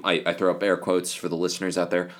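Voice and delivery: normal talking voice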